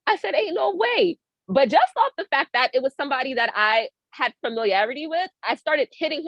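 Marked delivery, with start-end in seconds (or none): 0.3-1.2 high-pitched retold disbelief. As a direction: react high, as if refusing to believe it. Stunned reaction to recognizing the intruder.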